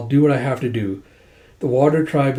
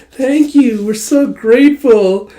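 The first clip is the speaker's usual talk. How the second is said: high, loud, drawn-out squeal of thanks; overjoyed thanks, stretched out and high; gushing gratitude; 9 semitones higher, 7 dB louder, slower than usual. Acted. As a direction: overflow with hammed-up gratitude. Characters gush thanks during a hug.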